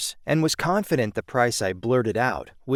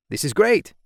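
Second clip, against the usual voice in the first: a bright, higher burst of praise; enthusiastic; 7 semitones higher than usual. Retold or imitated. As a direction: sound truly pleased, pitch up. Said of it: "he responded enthusiastically".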